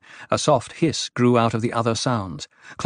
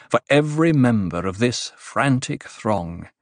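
ordinary delivery

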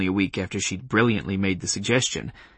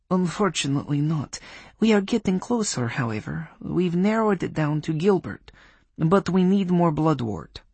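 put-on Polish accent; 7 semitones higher than usual. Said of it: "in a Polish accent".

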